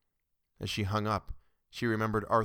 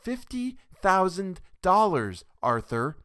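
loud, slow, high, pressing the sum; insistent interruption; 10 semitones higher, 8 dB louder, slower than usual. Acted. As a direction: drive the number home over him. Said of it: "Norma interrupted".

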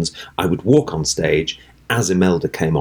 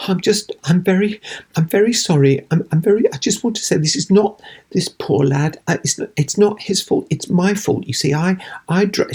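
high, quavering, tearful pitch; tearful apology; 11 semitones higher than usual. Retold or imitated. Actress apologising tearfully to the audience, high tearful plea. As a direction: plead through near-tears, voice up high. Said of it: "was very tearful, going".

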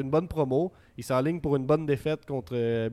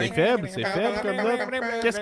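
indignation moqueuse; mock outrage; 8 semitones higher, slower than usual. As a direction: haut et lent, faussement outré. Teasing the low rating. (faussement scandalisé)

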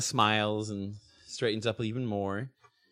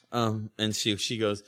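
ordinary talk

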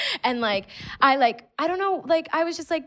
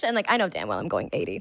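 usual speaking voice

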